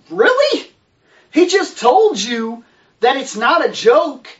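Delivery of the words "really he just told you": high disbelieving really; incredulous. Real, not acted. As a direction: squeal it in utter disbelief. Incredulous reaction to the heroine.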